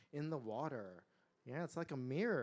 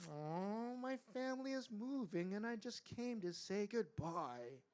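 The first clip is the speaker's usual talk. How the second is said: bashful blushing; 6 semitones higher than usual. A narrator's reading. Blushing tiger explains his visit, bashful. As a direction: say it shyly, like owning up. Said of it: "He blushed".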